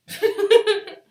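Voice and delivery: very loud, high burst of laughter; real cackling giggle fit; laughing. Real, not acted. Laughs at own quiz.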